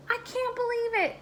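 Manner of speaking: high, shocked gasp of disbelief. Acted. Donut Arnie hearing the baker's news, gasping in shock. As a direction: gasp it out, stunned. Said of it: "Arnie gasped".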